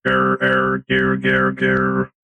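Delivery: deep, malfunctioning robot voice. Acted. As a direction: glitch out like a broken robot. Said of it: "I'm a robot".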